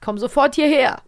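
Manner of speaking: a hint of an outraged lord calling his daughter; stern father calling. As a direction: summon her sharply across the house. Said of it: "Was sagt er? A".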